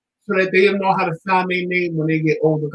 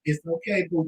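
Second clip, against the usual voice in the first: much softer, gentle comforting; soothing; 2 semitones lower, 9 dB quieter than usual. Retold or imitated. Uncle soothing his upset niece, softly. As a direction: hush her like calming a child. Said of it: "And I'm like".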